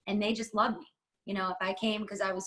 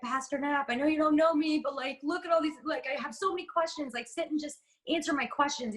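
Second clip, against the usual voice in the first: higher, eager, rushing plea to the pastor; a keen kid begging for answers, voiced higher; eager pleading; 6 semitones higher than usual. Eager young self begging pastor.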